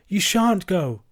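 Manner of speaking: high-pitched wifely protest; alarmed protest. A narrator's reading. Vicar's wife stopping her husband, alarmed protest. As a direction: forbid him in mild alarm.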